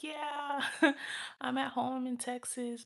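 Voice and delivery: high, giggly pretend reassurance; laughing fib. Speaker covering up jail to the man, high and laughing. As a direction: pitch it up and laugh through the lie.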